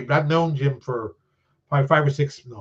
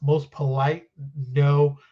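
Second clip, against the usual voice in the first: ordinary talk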